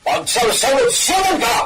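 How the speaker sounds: a high, scolding shout; angry shout. Acted. Master scolding his servant, a high angry shout. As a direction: lash out at him with it.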